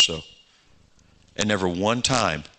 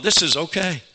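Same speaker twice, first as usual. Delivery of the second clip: happy; 8 semitones higher than usual. Retold or imitated. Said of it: "I got a little happy".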